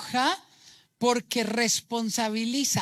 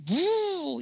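high falling "uuh" swoosh; imitated car swoosh; 7 semitones higher than usual. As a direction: mimic a car swooping in with a high hoot. (zumbido agudo imitando un auto)

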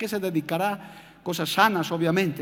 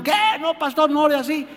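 alarmed protest; 7 semitones higher than usual. Retold or imitated. Migrant protests pastor's prayer.

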